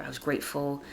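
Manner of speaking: normal talking voice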